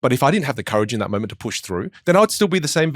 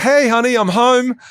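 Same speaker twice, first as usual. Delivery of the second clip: much higher and louder, bright homecoming call; loud cheery greeting; 12 semitones higher, 7 dB louder, slower than usual. Pretend. Coming home and greeting the partner with new volume. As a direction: call it out big and cheery from the door. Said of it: "the next time you're like".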